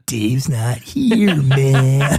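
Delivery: slow, drawn-out stoner voice; spaced-out hippie drawl; Tommy Chong. Acted. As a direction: stretch here and man lazily. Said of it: "is just Tommy Chong from fucking my childhood".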